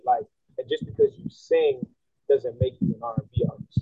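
usual speaking voice

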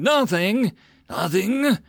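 high, loud, disbelieving cry; a young lord shouting at his calm guard; incredulous yell. A narrator's reading. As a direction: throw the word back in his face twice. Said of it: "Titus yelled".

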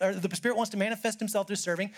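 ordinary talk, nothing marked

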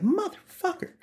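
high squeaky disbelieving curse; stunned curse. Retold or imitated. Stunned new hire reacting to the Oprah job offer, squeaky shocked curse.

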